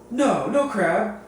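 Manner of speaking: high, slow, drawn-out brush-off from the vendor; a mock-incredulous vendor waving off a customer's question; dismissive no. Booth vendor dismissing a customer, high slow scoff.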